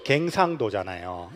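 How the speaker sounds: slow, low Gyeongsang drawl on 갱상도; Gyeongsang dialect. Shown as a demonstration. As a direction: lean into the Gyeongsang accent. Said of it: "우리는 갱상도잖아요". (느리고 낮은 경상도 사투리로)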